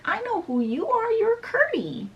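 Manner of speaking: high-pitched princess voice; excited recognition. Retold or imitated. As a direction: keep it high throughout, delighted to know who it is. Princess greets boy she knows.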